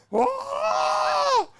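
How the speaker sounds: high-pitched mock scream of people falling; imitated scream. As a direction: let out a long, high scream, re-enacting the panic. Reenacting the screams as people fell.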